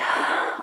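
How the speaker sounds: soft unvoiced sigh; tired sigh; weary sigh. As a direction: sigh before giving in. Crawdad reluctantly agrees.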